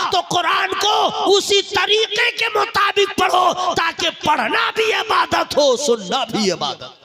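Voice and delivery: loud ringing sermon, words stretched; preaching at full force. Acted. Preacher to the congregation, booming full-force preaching. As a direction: thunder it out to the crowd.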